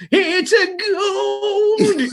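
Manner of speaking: high, very slow, croaky crazy prospector. Pretend. Describing his Old West self, a gold-crazed prospector cry. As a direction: stretch it out like a gold-mad old coot. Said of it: "become the".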